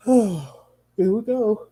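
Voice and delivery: words stretched through a yawn. Real, not acted. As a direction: talk while yawning. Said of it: "If I yawn one more time".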